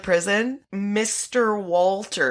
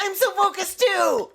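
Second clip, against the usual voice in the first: high-pitched, over-eager echo; hammed-up reply from someone equally keyed up; mock intense focus; 12 semitones higher than usual. Drugged officer answering the partner, in hammed-up intensity.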